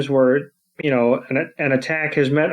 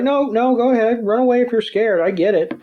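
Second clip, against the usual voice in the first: higher, teasing taunt; mock taunt; 8 semitones higher than usual. [name] goading Georgia to run away, high teasing voice. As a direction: goad her like she's already won.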